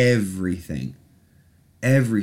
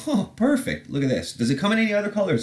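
approving product team; 13 semitones higher, faster than usual. Acted. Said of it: "And they're like".

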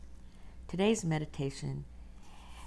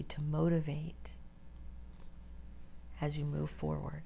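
guided meditation; 4 dB quieter than usual. Acted. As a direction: ease them into stillness, breathy and unhurried.

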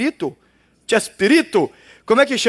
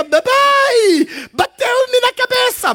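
put-on high, slow whiny child wail; Kiko's cry; 13 semitones higher, much slower than usual. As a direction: cry it out like a spoiled boy. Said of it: "Ele grita".

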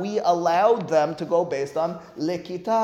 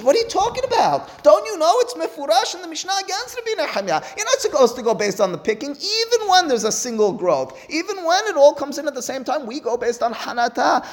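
incredulous objection; 10 semitones higher than usual. Acted. Rish Lakish objects with disbelief.

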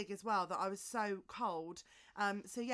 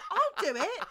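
eager mum; 10 semitones higher, 10 dB louder than usual. Retold or imitated.

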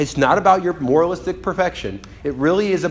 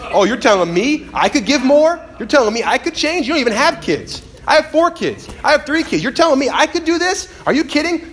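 indignant retort; 11 semitones higher, 3 dB louder than usual. Retold or imitated. Acted defensive retort to criticism.

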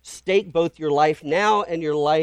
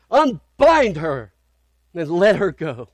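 ringing, slow proclamation, higher and louder on Unbind; proclaimed command; 4 semitones higher, 8 dB louder, slower than usual. Acted. Preacher voicing God's command to death, booming proclamation. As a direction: declare it over death with full authority.

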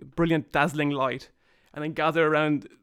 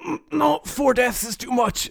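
high, tired royal complaint; a hint of a dying king; weary king voice; 8 semitones higher, 4 dB louder than usual. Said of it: "he sits up and goes".

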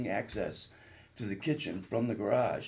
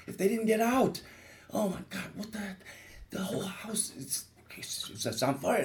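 high, breathless retold panic with some words near whispered; frantic, pitched-up fear for family; panicked inner thoughts; 10 semitones higher than usual. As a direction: relive the rising dread of the burning house. Panicked thoughts about family in fire.

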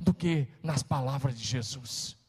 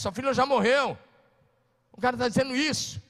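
raised, higher preacher's voice, driving on; fiery preaching; 6 semitones higher, 4 dB louder than usual. Acted. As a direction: preach it to the congregation with fire. Pastor preaching at full force.

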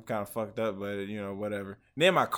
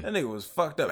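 usual speaking voice

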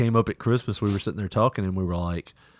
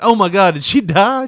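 mock alarm; 8 semitones higher, 8 dB louder than usual. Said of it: "I was like".

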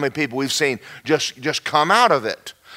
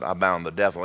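low, softer aside to a coworker; hushed boast; 12 semitones lower, 7 dB quieter than usual. Retold or imitated. Employee whispering to coworker about boss, hushed. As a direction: confide it proudly, low.

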